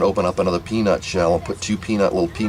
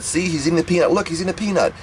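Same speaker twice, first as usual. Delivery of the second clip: high-pitched imitation of mom proving her point; insistent mother; 9 semitones higher than usual. Mother showing her worried child he's wrong, high insistent imitation. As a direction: insist in a raised, mock-mother voice.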